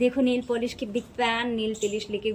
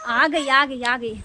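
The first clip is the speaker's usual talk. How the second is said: louder, excited repeated cry; rain's here, call it out loud; excited about rain; 8 dB louder, slower than usual. Real, not acted. Rain suddenly pours down. (तेज़ बारिश पर उत्साह से ज़ोर से पुकारना)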